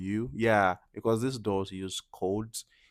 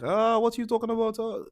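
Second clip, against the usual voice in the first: much higher, loud, fast confused outburst; overwhelmed learner; 13 semitones higher, 5 dB louder, faster than usual. Retold or imitated. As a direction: panic at rapid Russian. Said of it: "You'll be like".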